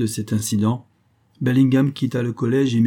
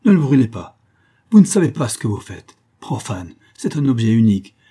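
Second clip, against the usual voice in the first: loud, urgent plea; impassioned protest at raised volume; desperate cry; 7 dB louder than usual. A narrator's reading. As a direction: plead loudly to save a treasure. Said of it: "cria Bellingham".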